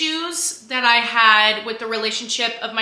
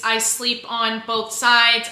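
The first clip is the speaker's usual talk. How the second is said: usual speaking voice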